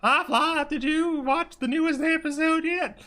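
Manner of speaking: very high falsetto chatter; high-pitched put-on cartoonish chat; high character voice. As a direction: do every voice in the same silly high pitch. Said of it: "do every voice like this".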